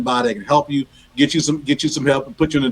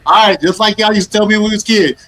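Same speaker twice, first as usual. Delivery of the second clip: higher, louder teasing call to the back of the van; mock hollered warning; 7 semitones higher, 5 dB louder than usual. Host imitating Reggie warning the elders in the van, loud and high. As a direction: belt it like a driver warning a vanload of elders. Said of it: "And you would always say".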